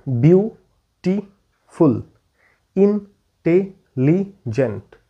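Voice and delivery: বিউটিফুল and ইন্টেলিজেন্ট stretched very slowly, every syllable apart. Shown as a demonstration. Teacher shows students the syllables, drawn out very slowly. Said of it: "এই যে বিউটিফুল বলি না".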